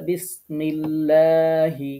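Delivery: বিসমিল্লাহি slowly with a thin লা; thin lam. Shown as a demonstration. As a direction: recite বিসমিল্লাহি lightly, lips flat. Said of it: "এভাবে পড়তে হবে".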